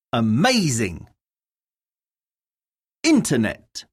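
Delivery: each word said alone, stressed syllable clearly hit. Shown as a demonstration. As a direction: model the stress for the class to repeat. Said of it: "Words have stress on different syllables".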